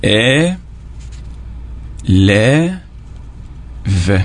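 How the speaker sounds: "et", "les", "v" drawn out, long gaps between; syllable by syllable. Shown as a demonstration. Teacher repeating the split word for pupils, syllable by syllable. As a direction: repeat "et", "les", "v" as three isolated sounds. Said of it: "Je reprends".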